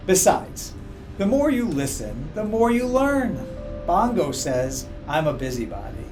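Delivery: plain reading voice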